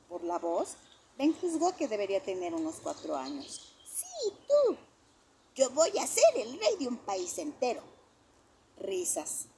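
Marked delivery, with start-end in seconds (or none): 3.8-7.8 put-on high child voice, puffed up; a small kid bragging; boastful child. A narrator's reading. A kindergartner brags to his friends, high child voice.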